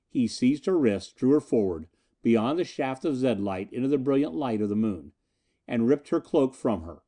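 ordinary delivery

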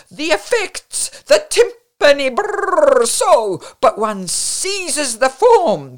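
high, loud raving over music; excited, theatrical foreign hostess; effusive hostess shouting. A narrator's reading. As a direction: build rapturously to shouted superlatives over the din. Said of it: "The enormous clatter ceased".

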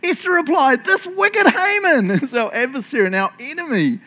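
high, loud, declamatory delivery; theatrical high-pitched proclamation; dramatic reading. Shown as a demonstration. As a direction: read it out like a grand accusation, hammed up. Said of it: "I can't do it like that".

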